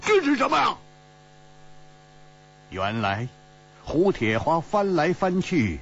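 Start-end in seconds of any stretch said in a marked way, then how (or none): 0.0-0.8 high, alarmed call; startled cry. A narrator's reading. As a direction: call out in astonishment at a very high pitch. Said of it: "胡铁花一声惊呼说".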